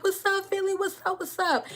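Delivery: sarcastic high greeting to a caller; mock cheery greeting. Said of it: "And I'm like".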